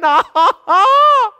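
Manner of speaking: loud, high burst of laughter; a big delighted laugh; overjoyed laughing out loud; joyful laugh. Real, not acted. Joyful laugh after quote.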